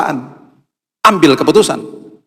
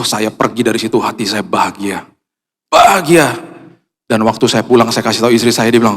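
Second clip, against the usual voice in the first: ordinary talk, nothing marked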